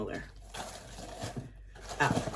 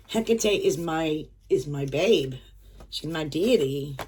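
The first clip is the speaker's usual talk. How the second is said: normal talking voice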